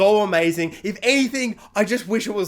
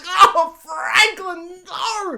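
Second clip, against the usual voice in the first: high, slow, crying wail of the name; hammed-up sobbing cry; exaggerated anguished shout, much higher; mock wail; 13 semitones higher, 5 dB louder, much slower than usual. Own reaction, mock wailing.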